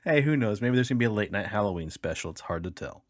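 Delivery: normal talking voice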